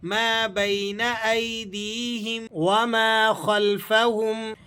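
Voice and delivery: slow, drawn-out chanted recitation; measured melodic Quran reading. Shown as a demonstration. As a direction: recite with tajweed, stretching the vowels.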